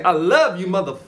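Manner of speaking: loud, high shouted I love you; mirror affirmation. Shown as a demonstration. To yourself in the mirror, a loud shouted affirmation. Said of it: "Look in the mirror and say".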